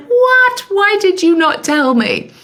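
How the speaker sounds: sudden high squeak of disbelief; shocked disbelief. Retold or imitated. Retelling her shock at her mum, squealing in disbelief.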